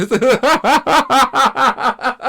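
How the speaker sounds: high, loud laughing burst; a real high and loud laugh. Real, not acted.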